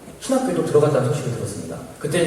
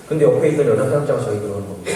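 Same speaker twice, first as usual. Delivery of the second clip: ordinary talk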